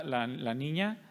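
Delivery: usual speaking voice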